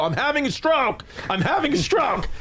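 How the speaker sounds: high, panicked retold cry of alarm; panicked stroke yell. To the crowd at the game, a high panicked mock yell. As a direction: yelp it out like you think you're dying. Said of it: "So I'm yelling that I'm having a stroke".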